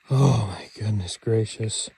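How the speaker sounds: ordinary delivery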